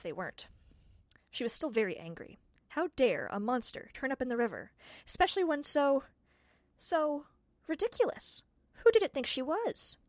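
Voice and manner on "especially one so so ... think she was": indignant. A narrator's reading. Tiffany's indignant thoughts.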